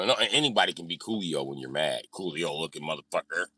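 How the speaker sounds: normal talking voice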